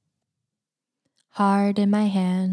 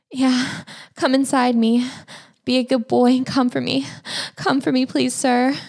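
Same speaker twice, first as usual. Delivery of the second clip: begging, pitched up; higher, pleading moan; 6 semitones higher than usual. Acted. Begging lover to climax.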